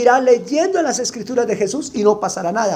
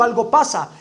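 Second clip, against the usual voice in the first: ordinary talk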